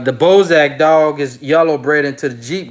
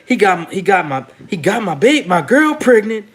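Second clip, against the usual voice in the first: imitating [name]; 4 semitones higher than usual. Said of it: "[name] say".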